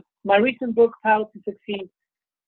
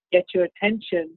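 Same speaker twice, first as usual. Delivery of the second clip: usual speaking voice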